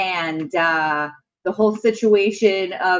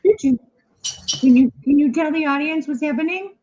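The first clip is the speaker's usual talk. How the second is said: higher playful pet voice; 7 semitones higher than usual. Real, not acted. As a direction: tease the dog sweetly. Talking to the dog.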